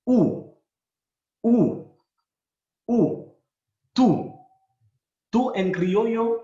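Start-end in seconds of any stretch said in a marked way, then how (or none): 0.1-4.4 "U" loud and higher, oo; Creole u sound. Shown as a demonstration. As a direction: repeat "U" like a drill, oo. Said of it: "la pronunciación es U". ("U" repetido alto y fuerte)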